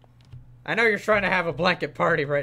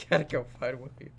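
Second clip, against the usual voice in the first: quiet; 4 semitones lower, 7 dB quieter than usual. Pretend. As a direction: lower and softer, resigned. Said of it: "I gotta go find William".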